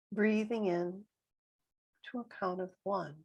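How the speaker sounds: calm meditation voice, long silences between phrases; guided meditation. Real, not acted. Guide counting breaths with listeners, calm and spacious meditation voice. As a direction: leave room for each breath, gently.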